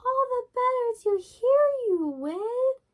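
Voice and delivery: put-on a wolf squeaking like a sweet old granny; wolf faking grandmother. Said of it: "disguise himself as the grandmother".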